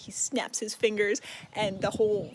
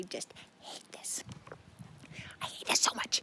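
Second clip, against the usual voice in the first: seething whisper; 8 dB quieter than usual. Real, not acted. Reviewer seething over a chapter.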